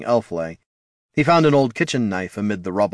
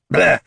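spitting bleh; 9 semitones higher, 7 dB louder than usual. Acted. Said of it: "Bert spat the cigarette".

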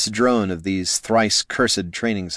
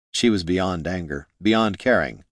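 plain reading voice

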